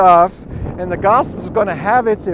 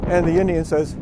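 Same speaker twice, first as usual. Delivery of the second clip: ordinary talk, nothing marked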